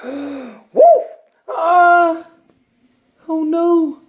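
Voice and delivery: high, loud shrieks of alarm; frightened pigeon. Acted. Pigeon shrieks in fright at the big puppy.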